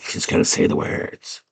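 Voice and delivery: whispered goblin aside; soft, voiceless hush. Retold or imitated. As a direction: imitate a listening goblin whispering to the others. Eavesdropping goblins whisper in retelling.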